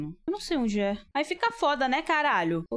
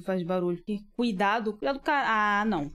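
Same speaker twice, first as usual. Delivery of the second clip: usual speaking voice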